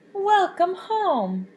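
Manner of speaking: slow, tender welcome home; welcoming. Acted. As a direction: stretch out a fond welcome. Friends welcome Kelp.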